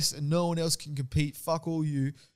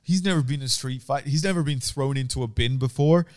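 usual speaking voice

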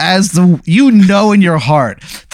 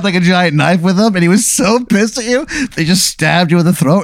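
normal talking voice

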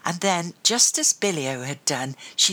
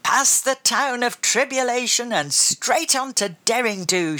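shouting; 7 semitones higher than usual. A narrator's reading. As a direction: shout it like a pirate charging. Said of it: "she shouted".

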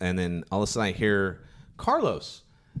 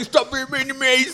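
much higher, louder squeal of mock hurt; mock whiny plea; 8 dB louder than usual. Joking at the guys ganging up on him, high mock plea. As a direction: plead in a high, childish-style squeal.

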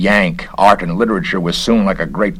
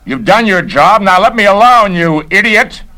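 higher and louder, angry all the way through; furious shouting; 13 semitones higher, 6 dB louder than usual. Acted. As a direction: drive the intruder away in a rage. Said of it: "screamed Stendhal".

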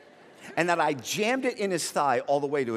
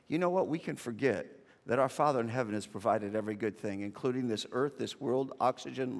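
ordinary talk, nothing marked

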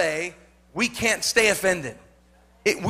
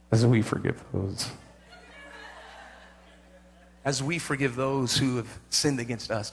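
mock prayer; 7 semitones lower, 5 dB quieter than usual. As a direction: pray it low and reverent. Mock-prays the Lord's Prayer.

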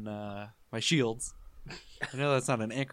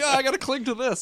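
high mock-panicked cry; 12 semitones higher, 4 dB louder, faster than usual. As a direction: play at clinging on in a fright, high. Mock panicked quote of own character.

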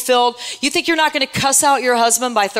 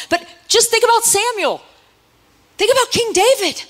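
high exhortation; 10 semitones higher than usual. Acted. Preacher urging the church to picture Samuel and David. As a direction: pitch leaps up on think about.

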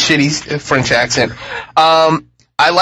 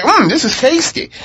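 relishing; 8 semitones higher than usual. Retold or imitated. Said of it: "He's like".

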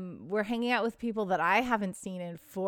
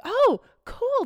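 higher, louder gushing oh cool; excited quote; 7 dB louder than usual. Retold or imitated. Old friends hearing about the alpacas, gushing excitedly. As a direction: mimic friends gushing with delight.

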